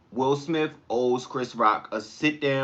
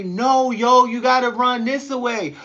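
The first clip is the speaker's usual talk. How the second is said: higher, louder mock shout; teammates calling out; 9 semitones higher, 6 dB louder than usual. Teammates yelling at him for running the wrong way, higher and louder. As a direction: call out the wrong-way runner.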